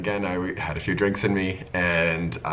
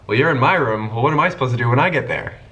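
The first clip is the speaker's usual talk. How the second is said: higher, louder and fast, put out; indignant friend; 6 semitones higher, 7 dB louder, faster than usual. Retold or imitated. Straight friend protesting over the phone, fast and louder. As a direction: complain quickly, mildly indignant.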